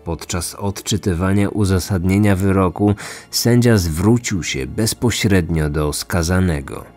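plain reading voice

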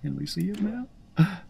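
cartoon squeak, pleading; squeaky fan. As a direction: beg to see him now in a tiny voice. Fan character asks eagerly.

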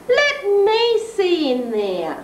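a hint of a horse's neigh; neighing mare. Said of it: "neighed the old grey mare".

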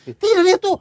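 high-pitched, stubborn imitation of arguing; mock insistent protest. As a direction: insist heatedly, as a retold complaint. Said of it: "Dia ngotot". (tiruan protes ngotot bernada tinggi)

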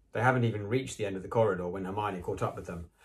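ordinary delivery, nothing marked

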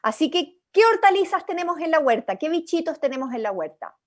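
normal talking voice

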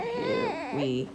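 high, slow weary sigh of a line; drawn-out eye-roll; a touch of whiny exasperation; exasperated. Retold or imitated.

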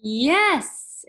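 high, loud joyful shout; girl finds her lost brother, joyful exclaim. A narrator's reading. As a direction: burst out with relief. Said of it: "exclaimed Yemi".

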